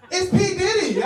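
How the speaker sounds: startled fan squeal; gasping fan. Retold or imitated. Mock fan gasping at a star. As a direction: gasp then high, loud recognition. Fans recognising him.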